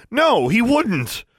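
high, loud, drawn-out denial; heated protest. A narrator's reading. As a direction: shout it slowly in his defence. Heated defence in a quarrel.

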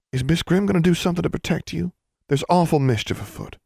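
put-on high, quick, anxious country girl; worried friend. A narrator's reading. Constance fears mischief against Alexandra, high and worried.

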